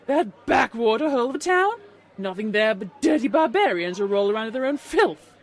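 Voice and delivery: loud, haughty contempt; disgusted sneer. A narrator's reading. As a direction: spit out snobbish disgust. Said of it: "spat the unicorn in disgust".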